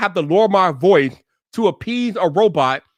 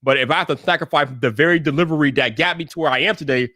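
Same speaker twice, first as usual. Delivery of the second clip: normal talking voice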